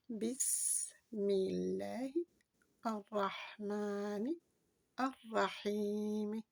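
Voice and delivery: Bismillahir, rahmanir, rahim said slowly, one word at a time with pauses. Shown as a demonstration. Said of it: "commence par le verset un". (mot par mot, très lentement)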